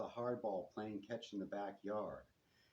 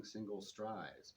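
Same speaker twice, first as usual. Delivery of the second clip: plain reading voice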